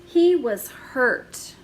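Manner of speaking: ordinary delivery, nothing marked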